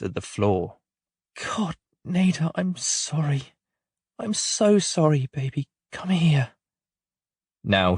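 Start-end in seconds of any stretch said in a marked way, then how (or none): 1.3-6.5 high, slow, tearful plea; mother collapsing into remorse before her daughter; broken apology. Acted. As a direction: voice cracking as she begs forgiveness.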